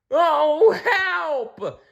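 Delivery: high, loud desperate cry; cry for help. Acted. Blinky cries for help scrambling outside. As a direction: wail for help as you scramble. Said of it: "cried Blinky".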